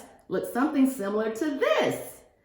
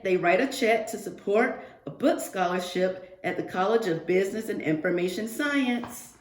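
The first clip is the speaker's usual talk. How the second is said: usual speaking voice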